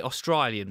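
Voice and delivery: "Australian" with the A turned to an eye sound; Australian accent imitation. Shown as a demonstration. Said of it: "replace the A with an I".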